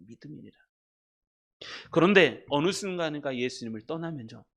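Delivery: usual speaking voice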